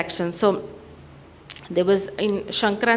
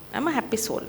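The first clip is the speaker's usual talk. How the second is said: usual speaking voice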